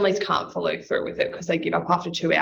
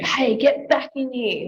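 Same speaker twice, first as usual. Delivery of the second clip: higher, drawn-out demand, no real shouting; slow, pitched-up mild mock yell; mock child's yell; 6 semitones higher than usual. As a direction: imitate a little one calling a parent back. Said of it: "they're yelling at you".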